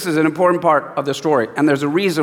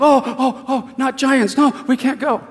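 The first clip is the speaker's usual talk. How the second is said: higher, whimpering panic of frightened people; whiny scared crowd; 7 semitones higher than usual. Retold or imitated. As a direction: whine like a crowd too scared to go. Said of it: "they're all like".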